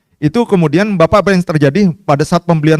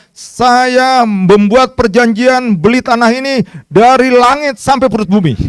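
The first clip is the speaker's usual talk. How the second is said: formal dictation; 5 semitones higher, slower than usual. Shown as a demonstration. Dictating formal contract wording.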